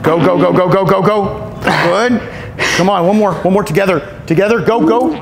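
high, loud cheering; loud hyped coaching. Real, not acted. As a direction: drive them through the last rep. Coach pushing final reps.

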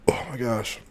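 unvoiced, pained whisper; whispered groan. Real, not acted. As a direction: breathe it out, worn out from the heat. Sick after hot wings.

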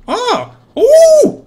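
sudden high yelp of surprise; startled shriek. Real, not acted. Gamer shrieks at a sudden scare in the game, high startled yelp. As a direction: squeal as something jumps out.

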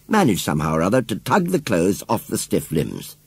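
ordinary delivery

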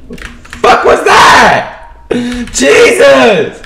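very high, loud disbelieving yell; outraged shout. Real, not acted. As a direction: scream it at the damage. Getting shot heavily.

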